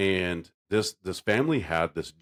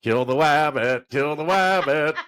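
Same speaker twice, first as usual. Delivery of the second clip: loud, sing-song cartoon hunter; Elmer Fudd; 6 semitones higher, 5 dB louder than usual. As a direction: turn your R's into W's, drawn out. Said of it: "That's Elma Fudd".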